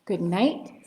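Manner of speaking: ordinary delivery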